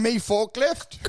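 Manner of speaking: mock Ukrainian broken English; Ukrainian accent. Mocking Zelensky's English, Ukrainian accent. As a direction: repeat it like a clumsy learner. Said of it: "he keeps going".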